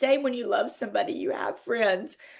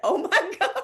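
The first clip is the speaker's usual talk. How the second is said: laughing reply; 7 semitones higher, 5 dB louder, much faster than usual. Retold or imitated. Retold reply to the MC.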